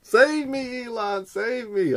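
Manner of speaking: high, pleading cry; falsetto, hammed-up plea; mock plea. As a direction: beg like a damsel. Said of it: "mock plea".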